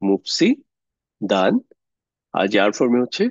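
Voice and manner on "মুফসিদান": very slow মুফসিদান, a long gap before দান; drawn-out answer. Shown as a demonstration. Teacher answering own quiz question, drawn out. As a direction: hold back the last syllable of মুফসিদান, then lift it.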